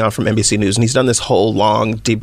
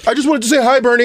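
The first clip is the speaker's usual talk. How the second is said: awkward Tom Steyer; 10 semitones higher, faster than usual. Retold or imitated. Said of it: "Tom Steyer comes up and is like".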